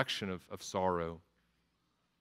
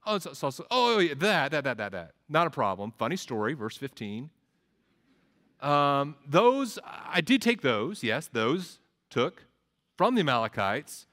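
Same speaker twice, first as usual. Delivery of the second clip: flustered Saul; 2 semitones higher, 4 dB louder than usual. Acted. Preacher voicing Saul caught with the sheep, flustered. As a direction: scramble for an excuse.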